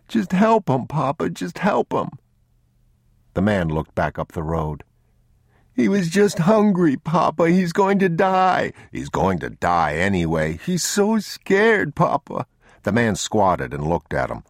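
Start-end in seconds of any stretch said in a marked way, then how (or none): 0.1-2.1 put-on very high child's plea; tearful pleading. Boy begs his father to help, tearfully pleading. 5.7-8.7 put-on very high, upset child voice; tearful pleading. Boy pleads with Papa for the hungry thief, tearful. 10.6-12.4 put-on very high, drawn-out child's wail; tearful pleading. Boy tells Papa how scared the thief is, tearful.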